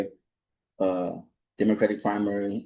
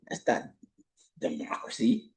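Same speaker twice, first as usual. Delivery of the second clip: whisper; 5 semitones lower, 7 dB quieter than usual. Real, not acted. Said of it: "whispered ending".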